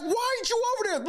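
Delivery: high-pitched imitation of an annoyed teammate; mock teammate complaint. Streamer voicing Austin Reaves complaining about LeBron, high mock whine. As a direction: squeak it out like a fed-up teammate. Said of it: "Austin Reaves is like".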